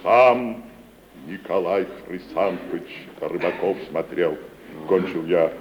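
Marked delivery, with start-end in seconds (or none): none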